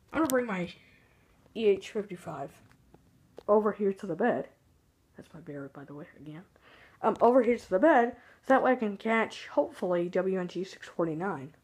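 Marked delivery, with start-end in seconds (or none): none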